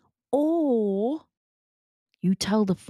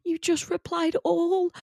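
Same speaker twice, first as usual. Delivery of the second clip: much higher, gasping mock alarm; shocked colleagues; 11 semitones higher than usual. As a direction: tease Anna in disbelief. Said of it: "They were like".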